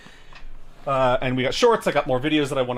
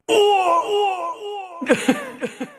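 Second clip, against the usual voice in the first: death scream; 5 dB louder than usual. Pretend. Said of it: "let me see if I can do the".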